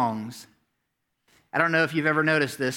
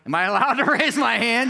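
husbands asking; 6 semitones higher, 4 dB louder than usual. Retold or imitated. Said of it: "husbands out there like".

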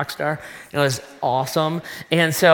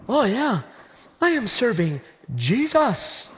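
higher, slow, self-satisfied sing-song; mock smugness; 6 semitones higher than usual. Preacher mocking his younger self, smug. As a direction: parody your old pious pride.

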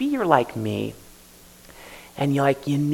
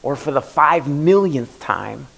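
ordinary talk

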